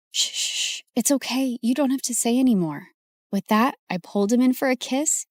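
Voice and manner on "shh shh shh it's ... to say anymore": a hushed, soothing whisper of shh; soft hush. Acted. Comforting her crush about his sick mom, hushed and soothing. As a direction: hush him gently, it's okay.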